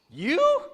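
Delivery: loud, high, disbelieving "You?"; incredulous one-word "You?", pushed hard; emphatic "you". Shown as a demonstration. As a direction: swoop "You" up sharply as a question. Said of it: "the emphasis is on the "you".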